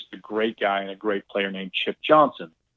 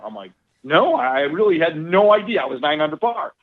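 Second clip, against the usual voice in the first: ordinary talk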